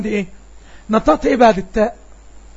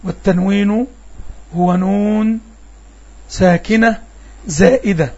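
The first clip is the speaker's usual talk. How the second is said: slow, measured recital of the definition; chanting the definition slowly, word by word; reciting the text; much slower than usual. Shown as a demonstration. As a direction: read it out like a memorized rule. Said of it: "الشيخ هنا بيقول التنوين".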